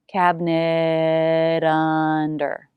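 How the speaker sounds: "Cabinet under" very slow with the T flapped into "under"; slow flap T demo. Shown as a demonstration. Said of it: "It will be a flap T".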